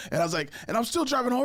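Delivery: ordinary talk, nothing marked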